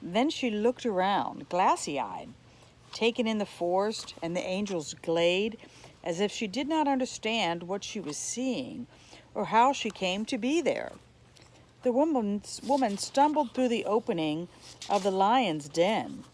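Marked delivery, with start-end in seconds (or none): none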